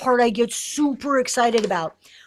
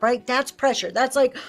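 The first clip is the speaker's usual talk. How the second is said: ordinary delivery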